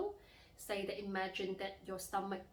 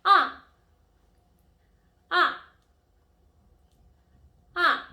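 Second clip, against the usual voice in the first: very loud, short "Ah" falling from high; sharp falling tone; 10 semitones higher, 18 dB louder, slower than usual. Shown as a demonstration. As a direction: snap "Ah" out high, then drop abruptly. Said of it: "really sharp and abrupt tone".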